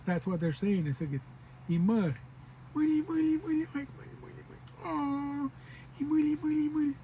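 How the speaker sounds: put-on high, cooing baby-talk money. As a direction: tease the aunties cooing like babies. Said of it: "aunties and uncles ... become babies".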